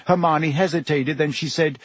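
usual speaking voice

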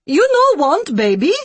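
high, loud broken English disbelief; can't believe them and is impatient; incredulous, impatient. A narrator's reading. Care worker doubting the couple, incredulous impatient broken English. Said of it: "incredulity and impatience".

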